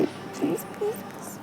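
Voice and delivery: tiny hushed please please; pleading whisper. Acted. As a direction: plead silently not to wake him. Wife tries to slip away quietly.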